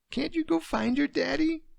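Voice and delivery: put-on high child's voice, close to crying; a little girl pleading, nearly crying; near tears. Daughter begging her daddy, near tears. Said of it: "Emily was near tears now".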